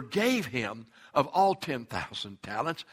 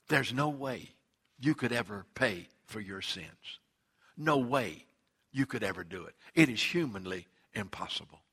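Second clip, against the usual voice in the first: low, soft and grave, quieter; gentle solemn Jesus; 5 semitones lower, 5 dB quieter than usual. Acted. Jesus telling the servant softly, grave and quiet.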